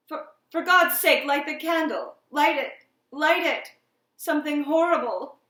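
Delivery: loud, high-pitched panicked outburst; desperate, frightened shouting; terrified pleading. A narrator's reading. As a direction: cry out in terror for help. Terrified man begs for light.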